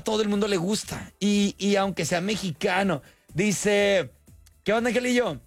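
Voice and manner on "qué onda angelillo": ordinary talk, nothing marked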